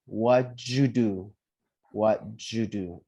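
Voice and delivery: "What you do" slowly, "you" turned into "ju"; teacher repeating "What you do" as what-ju-do; "What you do" drawn out with a clear j sound; corrected model. Shown as a demonstration.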